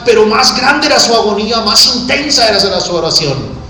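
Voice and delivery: fervent preaching, high and loud; prédica a plena voz. Acted. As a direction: preach it with rising, impassioned force. Predica a toda fuerza. (predicación encendida, a voz en cuello)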